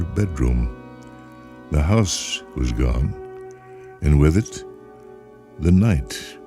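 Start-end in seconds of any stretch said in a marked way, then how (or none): none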